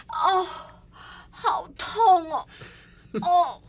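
high, loud, slow whimpering; mock agony, dragged out; exaggerated pain moaning. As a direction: groan for everyone to hear. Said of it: "这种呻吟声".

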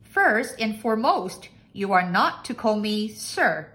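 loud lordly master; pompous, projecting master's voice; bossy master. A narrator's reading. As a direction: lay down the rule loudly. Master lays down rules.

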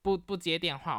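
usual speaking voice

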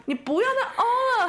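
mock scolding through laughter; laughing scold. Retold scolding while laughing.

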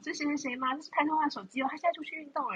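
a hint of a chirpy mom; lightly voiced mom answering a phone. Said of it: "她就接着电话说".

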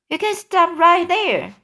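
high, loud sharp cut-off; said sharply. A narrator's reading. Earthworm cuts James off, sharp, high and loud. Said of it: "the earthworm said sharply".

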